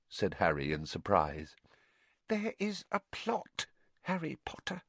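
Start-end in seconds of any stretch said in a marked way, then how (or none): 2.3-4.8 soft, high elf whisper. A narrator's reading. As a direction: lean in and whisper it. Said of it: "whispered Dobby".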